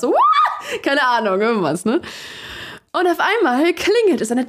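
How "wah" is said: sudden high shriek with a laugh; mock yell at the game, half laughing; gaming yell. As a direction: let out a wild gamer's scream. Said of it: "ziemlich laut geschrien".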